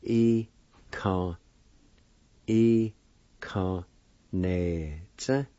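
slow second part with a gap after each syllable. Shown as a demonstration. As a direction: sound it out piece by piece. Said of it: "Second part".

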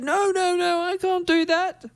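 high, emphatic Peter refusing. Acted. Peter refusing the vision, a high emphatic protest. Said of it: "He said".

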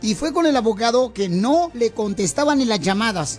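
high-pitched, punchy commercial pitch; radio ad read. Acted. Radio host pitches injury lawyers to listeners, high-energy ad read. Piolín reads a lawyer advertisement.

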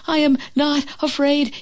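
understated frightened shout; trembling mock shout. A narrator's reading. Frog trembling after the avalanche, mild frightened shout. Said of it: "he shouted".

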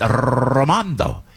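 Ramondo with a rolled R, said slowly; rolled R Italian name. Retold or imitated. Asking the doorman for help, Ramondo said with a slow rolled R. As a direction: roll the R in Ramondo and stretch it out. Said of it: "you can roll your R's".

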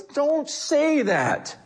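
high, drawn-out forbidding command; emphatic rebuke. Acted. God answering Jeremiah's excuse, emphatic rebuke. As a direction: stretch it out as an urgent correction.

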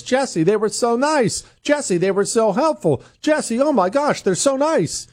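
high gushing customer voice. Retold or imitated. Customers praising service to Jesse, high and gushing. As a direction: gush like a delighted caller.

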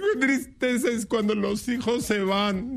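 high-pitched, hammed-up sobbing lament; mock crying. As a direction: wail it like a tearful neighbor woman. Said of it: "llorando la comadre".

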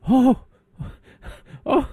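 very high mock shocked oh; shocked gasp. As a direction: gasp like a scandalized prep. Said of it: "There was a visible shaking in the crowd".

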